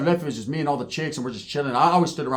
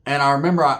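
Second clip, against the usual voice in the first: ordinary talk, nothing marked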